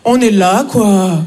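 mock blasé Parisian; Parisian shrug. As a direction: mutter it like a jaded Parisian. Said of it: "comment ça va, c'est".

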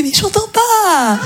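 high, drawn-out mock shout over the metro; can't-hear call. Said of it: "elle entendait pas parce que le métro". (faux cri aigu de celle qui n'entend pas)

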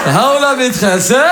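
slow, drawn-out voice of the father; father's reaction. Retold or imitated. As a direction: imitate dad reacting to the teacher. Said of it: "Mon père, il m'a regardé, il m'a dit".

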